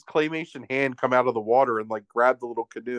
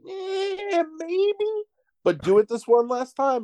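far higher, hammed-up, hedging drawl; put-on king voice; slower than usual. King answering Daedalus, high put-on hedging voice. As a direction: play a shifty king stalling.

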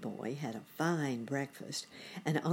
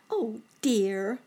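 put-on high, louder boy-bunny voice; dismayed cry; 8 semitones higher, 6 dB louder than usual. A narrator's reading. Boy bunny dreading a hot day, high dismayed cry. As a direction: cry out in mild dismay, pitched up. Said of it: "cried Sammy".

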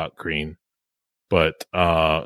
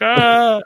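high, loud imitated charging scream; hammed-up "aah" while giving chase; mock battle yell; 5 dB louder than usual. Imitating Han running after the troopers, mock yell. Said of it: "runs after the Star Troopers".

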